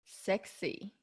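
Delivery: low, soft seductive voice; sexy voice. Shown as a demonstration. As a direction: try out a sultry tone. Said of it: "with the sexy voice".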